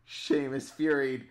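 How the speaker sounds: usual speaking voice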